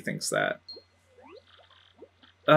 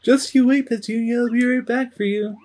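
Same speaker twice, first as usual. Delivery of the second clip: higher, bright little brother promise; high boy voice; 8 semitones higher, 5 dB louder than usual. Acted. Punio promises his sister Petunia, high-voiced.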